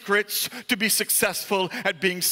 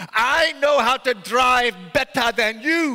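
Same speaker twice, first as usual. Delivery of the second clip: indignant, raised storyteller's character voice; defiant shout; 4 semitones higher, 5 dB louder than usual. A narrator's reading. Truck driver brushing off market women's warning, defiant shout. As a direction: throw it back at them defiantly. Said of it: "he shouted".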